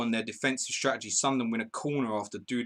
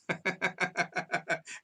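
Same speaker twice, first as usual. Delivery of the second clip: laugh; 8 semitones higher than usual. Real, not acted. Laughing at a viewer comment.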